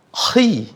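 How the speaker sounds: Khi said heavy, dark I vowel; thick khi. Shown as a demonstration. Teacher models khi for students.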